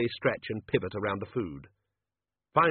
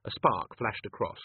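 usual speaking voice

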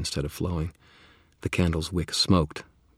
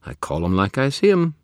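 high, bright sidekick voice; cheeky spirit voice; 9 semitones higher, 9 dB louder than usual. Acted. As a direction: play the cocky skull spirit. Bob character voice.

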